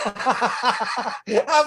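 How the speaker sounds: laughing, voice up high. Real, not acted. Amused at a viewer spotting the frog, laughing. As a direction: crack up at the comment.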